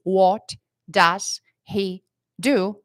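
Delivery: What does he do? slow, words kept apart; separate words. Shown as a demonstration. Teacher contrasting the slow form with the fluent one. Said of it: "Em vez de".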